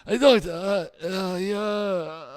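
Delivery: patient's high, faltering voice; high-pitched patient. Acted. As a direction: stumble along in a put-on high voice. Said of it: "I've been speaking like this".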